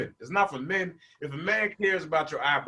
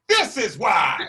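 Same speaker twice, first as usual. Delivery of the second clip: louder, higher retort; voiced body; 7 semitones higher, 7 dB louder, slower than usual. Stomach answering its owner, louder and higher. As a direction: answer as your own gut. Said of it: "my booty was like".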